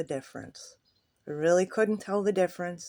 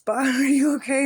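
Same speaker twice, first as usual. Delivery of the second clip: worried coworker; 7 semitones higher, 7 dB louder than usual. Retold or imitated. Maintenance lady checking on him.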